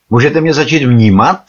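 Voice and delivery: loud, high, exasperated demand; quite angry. A narrator's reading. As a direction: demand to be noticed, pitch and volume up. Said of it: "pokračoval jsem již dost rozlobeně". (dost rozlobeně, zvýšeným hlasem)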